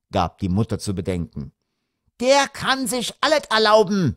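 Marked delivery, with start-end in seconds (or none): 2.2-4.1 high-pitched bratty griping; griping daughter. A narrator's reading. Daughter griping about her brother, aggrieved. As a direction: gripe shrilly about the favoured brother. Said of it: "meckerte die Tochter".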